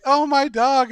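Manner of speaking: hammed-up horrified dog owner; mock dismay. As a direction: shriek it like a shocked owner. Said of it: "the lady's like".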